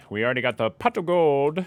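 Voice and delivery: bad Irish accent attempt; weak leprechaun Irish accent. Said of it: "That was terrible again".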